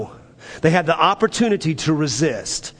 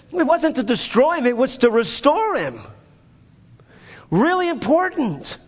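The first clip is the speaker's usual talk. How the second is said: rising preaching fervor; 8 semitones higher than usual. Acted. Pastor pressing the point to the congregation, climbing in intensity. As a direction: pitch climbs high as the point builds.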